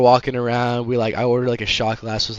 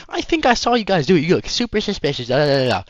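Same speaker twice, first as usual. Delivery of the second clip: high, pushy imitation of the accusing mom; hammed-up accusing stranger; mock angry lady; 6 semitones higher, 4 dB louder than usual. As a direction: mimic the heated lady, pitched way up. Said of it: "she's fucking screaming".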